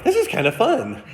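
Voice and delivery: high, sing-song carefree voice; breezy and unbothered; mock cheerful. To panicking passengers, high breezy cheer. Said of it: "we're just like".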